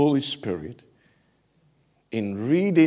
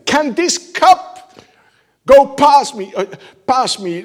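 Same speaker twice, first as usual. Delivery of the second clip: anguished shout; 13 semitones higher, 11 dB louder than usual. Retold or imitated. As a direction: drag it out in loud anguish. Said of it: "I think he did it like this".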